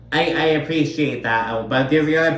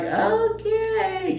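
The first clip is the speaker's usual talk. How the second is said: mock okay. Mocking group reaction.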